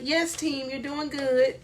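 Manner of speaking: soft, timid cheer with no power; hushed, half-hearted team praise; mock meek coach. Shown as a demonstration. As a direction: imitate a coach too mousy to rally anyone. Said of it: "doesn't need my voice to be like".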